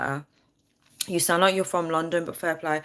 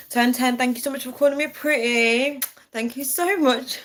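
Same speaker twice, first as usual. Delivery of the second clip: flattered laughing thanks; 7 semitones higher than usual. Real, not acted. Viewer called her pretty.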